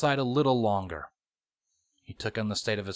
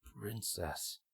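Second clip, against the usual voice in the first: much softer, muttered to himself; 2 semitones lower, 17 dB quieter than usual. A narrator's reading. Captive captain catches the word, soft mutter. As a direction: mutter the word under your breath. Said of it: "Shining muttered".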